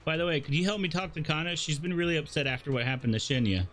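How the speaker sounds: ordinary delivery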